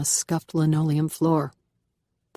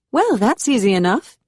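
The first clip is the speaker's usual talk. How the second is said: higher, louder South American lilt with a Southern drawl; South American Southern accent; 6 semitones higher, 6 dB louder than usual. A narrator's reading. Curious housemate offers the new girl help, South American Southern accent, higher and louder. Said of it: "a South American cadence slowed with a Southern drawl".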